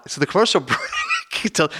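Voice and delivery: normal talking voice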